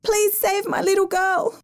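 high, frantic begging; mother begging rescuers to save her girl; desperate plea. A narrator's reading. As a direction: plead in panic for her child. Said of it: "she screamed".

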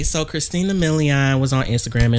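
ordinary talk, nothing marked

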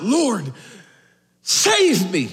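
Peter; Peter crying out. Retold or imitated. Call out with a pause before the plea. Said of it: "Peter cries out".

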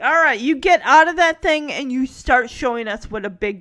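loud, emphatic mock telling-off; imitated stern order. Uncle ordering Michelle out of the crib, imitated stern command. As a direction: order the child firmly, hitting each beat.

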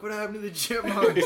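high, loud, eager mock question; friends asking. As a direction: play the curious friends. Voicing friends on Snapchat.

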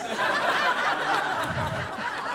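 unvoiced high laugh; high breathy laugh. Real, not acted. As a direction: burst out laughing. Laughing at typo.

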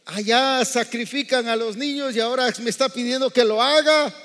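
rising to a loud, high shout on haga; fiery preacher climax; preaching at full force. Acted. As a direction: hammer the point home to the congregation.

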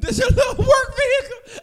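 high, loud, laughing through the quote; squealing laughing repeat; laughing mock quote. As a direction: crack up while repeating his line.

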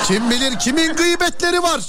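high, slow mock gossip with a laugh; teasing. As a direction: tease like sharing juicy gossip. Teasing caller about phone gossip. (alaycı, gülerek dedikodu tonu)

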